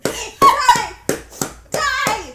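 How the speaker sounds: loud high battle cry; furious yelling. Real, not acted. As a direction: scream at the spider. Squashing the spider.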